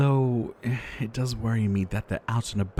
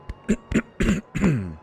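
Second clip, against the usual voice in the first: hacking loud cough; a sick man's sudden fit; harsh cough that cuts the sentence; 8 semitones higher, 4 dB louder than usual. Acted. Ill pharmacist coughing.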